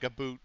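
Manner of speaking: "a boot" with a long oo, Canadian style; lightly imitated Canadian "a boot"; Canadian-accent "about". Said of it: "he's got that Canadian accent".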